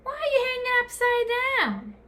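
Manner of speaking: a strange bat calling out to a stranger; high squeaky creature question; loud bat voice. A narrator's reading. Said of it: "a loud voice said".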